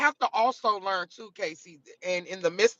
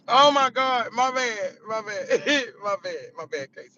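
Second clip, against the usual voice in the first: winding down from laughter. Real, not acted. Streamer still apologising to Casey, laughter subsiding. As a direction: come down from a laughing fit. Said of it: "I needed this laugh".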